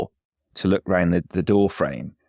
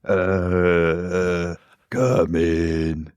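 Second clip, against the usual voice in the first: zombie voice; much slower than usual. Acted. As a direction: mumble it like a zombie. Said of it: "you seem to have lost your zombie voice".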